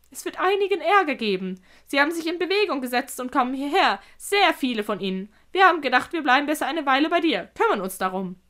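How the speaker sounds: lightly piping, animated creature; reporting spider. Acted. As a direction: report the coming trouble emphatically.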